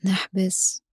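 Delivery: slow, calm breathing cues; guided breathing. Acted. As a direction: count the breath slowly and softly.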